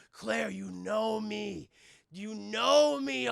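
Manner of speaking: drawn-out, deliberate appeal; mock-earnest slow plea; slow pleading. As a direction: plead slowly, stretching each word. Acts Chris pleading with Claire.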